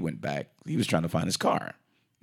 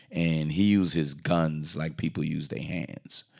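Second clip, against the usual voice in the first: usual speaking voice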